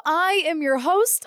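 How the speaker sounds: usual speaking voice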